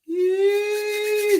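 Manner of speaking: a high, loud whoop of excitement; a big excited whoop at the viewer's question; excited yee. Real, not acted. Hype cheer for next question.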